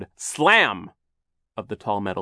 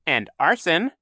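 high, loud little interjection; piping up; 8 semitones higher, 5 dB louder than usual. A narrator's reading. Villain adding to his own charges, piping up high. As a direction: chime in brightly with a raised pitch. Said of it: "Count Olaf piped up".

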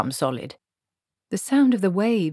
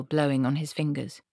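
plain reading voice

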